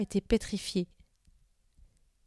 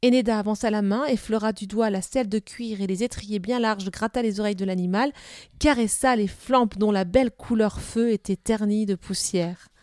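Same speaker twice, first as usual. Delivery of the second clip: ordinary delivery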